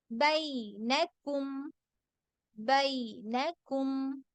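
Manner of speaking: slow recitation of ബൈനകും with eased diphthong; softened leen ay. Shown as a demonstration. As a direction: stretch the ബൈ gently in ബൈനകും. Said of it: "മയപ്പെടുത്തി പറയുന്നതിനെയാണ് ലീൻ".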